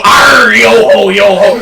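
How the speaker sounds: growled storybook-pirate accent, drawn out slowly. Shown as a demonstration. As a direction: roll out a hearty 'arr' in pirate accent. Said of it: "and I can go".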